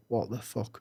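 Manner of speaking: low, soft stunned mutter under the breath; quiet shocked aside; muttered disbelief. Retold or imitated. Inner reaction, dropped voice.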